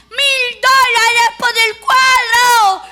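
high, loud, very slow bid shout. Retold or imitated. Bidder shouts an offer, high and loud. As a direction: bellow the bid across the room. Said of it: "empezó a gritar".

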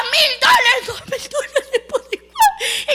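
very high, loud, laughing bid; shouted bid. Retold or imitated. Third bidder outbids, shouting high. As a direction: top the others with a yell. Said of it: "Y otro gritó".

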